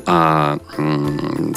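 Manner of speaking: ordinary talk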